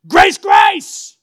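high, full-force cry of grace; shouted grace. Shown as a demonstration. Preacher showing the church how to shout grace, very loud. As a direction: shout it over the situation. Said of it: "Shout grace".

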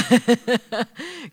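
high-pitched real laugh. Real, not acted. Host amused before calling Chris up, laughing. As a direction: let the laugh run on.

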